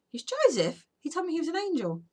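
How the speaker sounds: loud, high-pitched disbelief; retold astonishment. Mum hears from teacher her son's part has changed, astonished. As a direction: blurt it out as a startled, incredulous reply.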